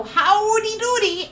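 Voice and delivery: high, slow, drawn-out cheery call; happy greeting. Retold or imitated. Grandmother coming into the room to greet family, imitated happy call. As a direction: imitate a grandmother's sing-out hello. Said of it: "she is just genuinely happy".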